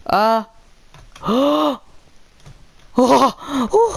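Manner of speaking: loud high startled gasps; startled cries. Real, not acted. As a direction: yelp at a sudden hit. Nearly killed by golem.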